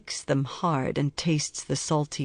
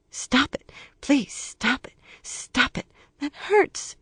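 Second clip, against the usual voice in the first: put-on high, pleading child's voice, breaking to a whisper; frightened child begging; 10 semitones higher, slower than usual. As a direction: beg like a scared little kid. Child begging abuser to stop.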